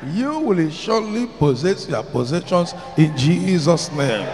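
shouted preaching declaration; fervent loud preaching. Real, not acted. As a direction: declare it at full force. Climactic declaration with music.

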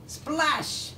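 high storybook splash; high sound-effect word. A narrator's reading. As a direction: toss the word up like spray. Sound word as cow lands.

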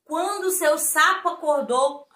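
plain reading voice

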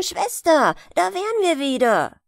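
höher, lauter Heimkehrruf; fröhlich die Rückkehr ausrufen; freudig gerufen. Acted. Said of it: "riefen die drei Brüder". (Brüder rufen heimgekehrt, laut und freudig)

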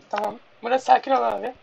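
ordinary delivery

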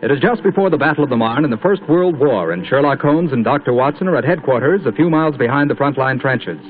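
usual speaking voice

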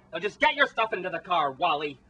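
usual speaking voice

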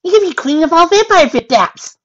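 very high, loud, bubbly; over-excited squeaky pony; optimistic. A narrator's reading. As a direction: squeal the silver lining loudly. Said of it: "said optimistically".

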